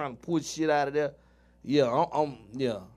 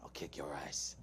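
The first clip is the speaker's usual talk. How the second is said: low whispered mock British threat; Jason Statham impression; 11 semitones lower, 14 dB quieter than usual. Impressing his cohost, a whispered Statham threat. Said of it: "I can do Jason Statham".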